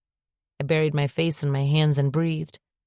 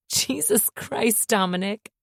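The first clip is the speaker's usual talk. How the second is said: slow, overwhelmed tearful words; sobbing; 6 semitones higher, slower than usual. A narrator's reading. Woman answering a proposal, sobbing slowly. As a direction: let it break out through tears. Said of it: "I sobbed harder".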